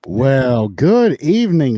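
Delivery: slow, loud, silky announcer voice; smooth sexy voice. Acted. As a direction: purr it out like a late-night host. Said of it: "his smooth, sexy voice".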